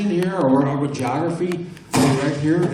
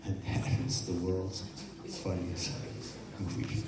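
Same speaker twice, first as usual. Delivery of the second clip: hypnotist suggestion; 3 semitones lower, 8 dB quieter than usual. Acted. Hypnotist guides volunteers to the funny movie. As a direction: lull them deeper as you speak.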